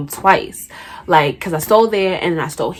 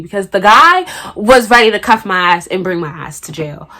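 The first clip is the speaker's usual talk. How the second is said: normal talking voice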